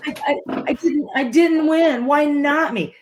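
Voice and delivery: high, plaintive mock whine; victim's mentality. Loser bemoaning the lost prize, mock self-pity. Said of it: "turned into a victim's mentality of".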